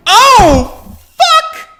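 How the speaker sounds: high, loud crying shout; terrified scream. Acted. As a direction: scream it in horror. Horrified outburst.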